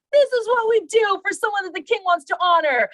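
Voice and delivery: high, loud town-crier announcement; public proclamation. Retold or imitated. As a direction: proclaim it to the entire city. Imagined proclamation through the city.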